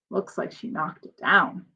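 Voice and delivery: ordinary delivery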